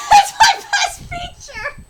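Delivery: high, loud words broken by laughter; shrieking laughter through the words; laughing repeat. Real, not acted. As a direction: crack up while repeating the line. Repeats the line, cracking up.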